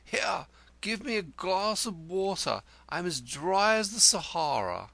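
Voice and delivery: breathy high poet voice; a hint of a parched dandy; 1 weary poet. A narrator's reading. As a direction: gasp it out, parched and theatrical. Weary poet returns, begs water.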